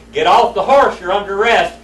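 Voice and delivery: retold holler. Grandfather shouting at the thief to surrender, loud mock holler. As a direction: yell it like a marshal cornering an outlaw. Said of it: "He hollered at him again".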